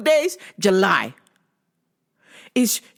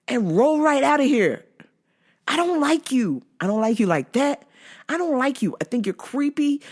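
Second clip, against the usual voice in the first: higher, emphatic rejection; fed-up telling-off; 7 semitones higher than usual. Retold or imitated. Worker sending the stalker away, fed up. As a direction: lay it out indignantly, pitch pushed up.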